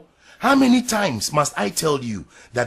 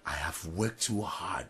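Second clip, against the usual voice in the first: whispering; 6 semitones lower, 9 dB quieter than usual. Acted. Husband's frustrated aside.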